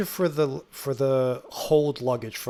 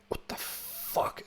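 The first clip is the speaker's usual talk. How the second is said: slow, breathy whisper under the breath; a stunned whisper at the Coke price; whispered what the fuck; 9 dB quieter, much slower than usual. Retold or imitated. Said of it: "and he's like".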